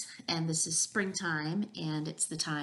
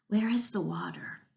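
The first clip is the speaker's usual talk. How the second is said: ordinary delivery, nothing marked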